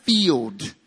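country accent. Shown as a demonstration. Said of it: "That's my country accent coming out".

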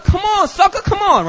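very high-pitched goading; acted cocky taunt. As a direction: egg him on to fight. Taunts held-back bouncer.

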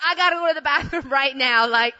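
loud, desperate mock demand; urgent. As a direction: insist on a bathroom at once. Urgent need for a bathroom.

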